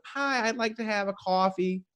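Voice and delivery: lightly voiced old lady, soft; a hint of a sweet old lady ordering. Old lady ordering coffee from barista, lightly voiced. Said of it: "the old lady comes up".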